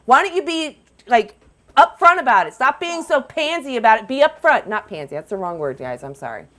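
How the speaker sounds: usual speaking voice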